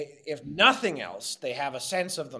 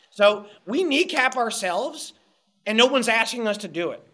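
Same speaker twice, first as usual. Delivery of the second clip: exasperated, raised pitch, emphatic; impassioned and louder, hammering the point; heated rant; 6 semitones higher, 4 dB louder than usual. Real, not acted. Guest rails against Canadians kneecapping themselves, heated and exasperated.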